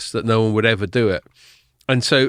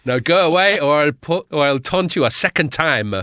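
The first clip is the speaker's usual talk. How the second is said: mock French accent, haughty taunt; obnoxious French bloke; 4 semitones higher than usual. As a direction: jeer in a silly French accent. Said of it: "this obnoxious French bloke at the top".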